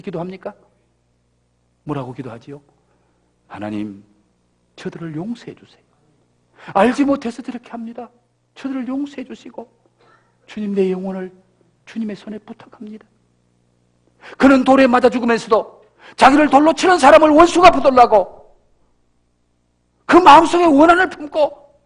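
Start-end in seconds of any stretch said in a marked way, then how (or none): 3.5-13.1 much softer, gentle plea; tender forgiving prayer. Pretend. 14.4-21.7 louder, higher emphatic sermon; forceful preaching. Acted.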